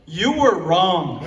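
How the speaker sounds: loud, slow, drawn-out declaration; emphatic rebuttal. Real, not acted. Grown child rebutting dad's advice in a toast, emphatic. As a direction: declare it loudly and slowly for laughs.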